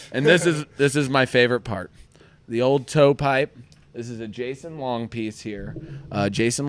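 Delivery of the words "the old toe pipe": usual speaking voice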